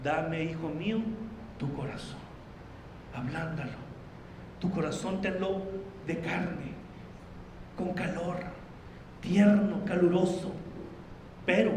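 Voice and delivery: soft, low, hushed preaching voice; soft, intimate pastoral appeal; tender sermon plea. Acted. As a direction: lean in and plead gently with the congregation.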